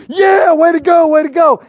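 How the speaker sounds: high, loud slowed cheer; crowd cheering. Retold or imitated. Fickle crowd now urging the blind man forward, cheering. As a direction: cheer him on.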